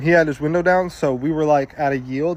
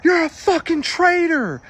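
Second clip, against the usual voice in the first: high, drawn-out re-enacted scream; retold scream; slower than usual. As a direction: scream the accusation slowly at a driver. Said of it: "I screamed".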